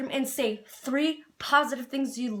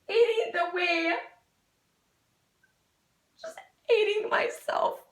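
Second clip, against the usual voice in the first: real crying, voice rising high; breaking into tears; 6 semitones higher than usual. Real, not acted. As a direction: break down crying as you recall it. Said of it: "I'm sorry if I'm crying".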